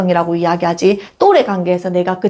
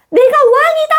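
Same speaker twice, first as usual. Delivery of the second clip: much higher, louder triumphant cry; child's proud shout; 4 dB louder than usual. Retold or imitated. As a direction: crow it like a little king. 아이가 왕이라고 외침. (왕처럼 우쭐하게 외치는 아이, 훨씬 높고 크게)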